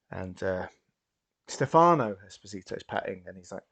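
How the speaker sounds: normal talking voice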